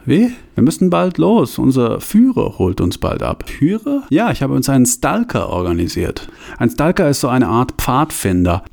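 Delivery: characters talk in a hideout; voiced dialogue scene. Bruno wakes Hans in the smoke chamber, lively. Audio drama scene with music.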